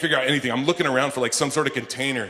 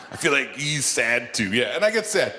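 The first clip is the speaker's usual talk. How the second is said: normal talking voice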